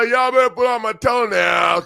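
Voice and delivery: loud defiant shout. Acted. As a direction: belt it out loud and high. Repeated as a shouted retort.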